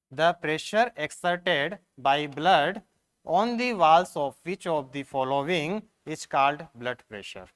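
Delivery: very slow, word by word, reading the question aloud; dictating an exam question at a crawl; slow dictation. Shown as a demonstration. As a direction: spell out each English word for the class. Teacher reads exam question aloud.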